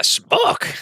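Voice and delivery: very high squeaky creature cry; one-word squeal in a high creature voice; little mephit voice. Acted. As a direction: yelp it as a tiny imp. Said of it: "and just says".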